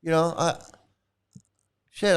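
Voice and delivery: ordinary delivery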